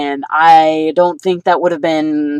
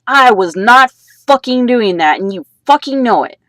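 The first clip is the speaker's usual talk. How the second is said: angry retort; 5 semitones higher, 4 dB louder than usual. Retold or imitated. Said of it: "I was like".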